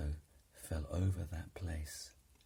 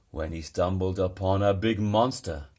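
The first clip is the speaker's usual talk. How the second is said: plain reading voice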